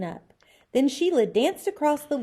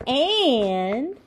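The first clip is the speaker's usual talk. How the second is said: stretched out word; 5 semitones higher, 6 dB louder than usual. A narrator's reading. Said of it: "she stretched out the word".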